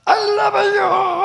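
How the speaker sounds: very slow, high, far-carrying shout of love you. Retold or imitated. The child shouts I love you toward the mountain, long and high. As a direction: stretch it out and call across the valley. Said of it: "하고 소리쳤는데".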